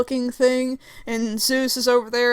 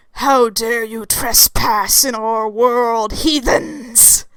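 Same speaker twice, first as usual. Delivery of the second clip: slow, booming villain indignation; wrathful Lucifer; 4 dB louder, much slower than usual. Acted. Lucifer confronts trespassers in his world, slow wrathful proclamation. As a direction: proclaim it like an outraged dark lord.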